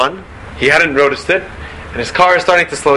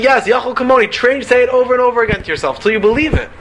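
ordinary delivery

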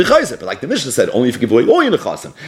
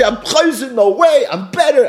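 mocking the woman's reversal; 10 semitones higher than usual. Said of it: "She did the same thing".

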